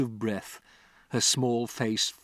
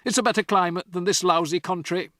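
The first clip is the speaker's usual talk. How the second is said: louder, dismissive dad; scornful father; 7 semitones higher, 5 dB louder than usual. Acted. Father to Matilda, loud and scornful. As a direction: sneer at the country.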